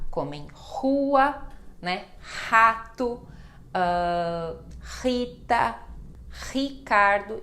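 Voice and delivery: rua, Rato, Rita, Ricardo with a breathy H-like R; initial R as H. Shown as a demonstration. Teacher modelling the initial R for students. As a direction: start each word with a throaty H-like R. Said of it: "ela tem som de ra".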